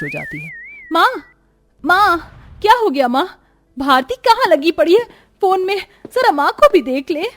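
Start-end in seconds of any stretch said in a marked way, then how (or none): 0.9-3.3 high, loud, panicked calls; frightened daughter crying out; alarmed calling mom. Acted. Daughter finds mother fainted. 3.8-7.2 high, loud call to sister; urgent shout. Acted. Calling sister for help.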